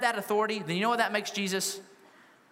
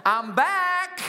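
triumphant crowing; 10 semitones higher than usual. Pretend. Imagined Jesus levitating above Jerusalem, triumphant. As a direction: crow it out with the last word held long.

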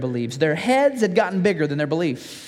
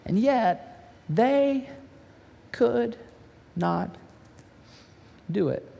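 slow, softer, trailing off in defeat; father's broken admission; 5 dB quieter, much slower than usual. Acted. Grieving father admitting to Jesus the disciples failed, halting. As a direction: let each word drop away, slower and quieter.

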